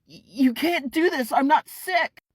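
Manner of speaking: high, loud panicked pleading; desperate Kent. Acted. Kent begging Ephraim to let him in, high panicked plea. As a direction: stammer out a frantic denial.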